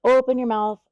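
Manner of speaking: normal talking voice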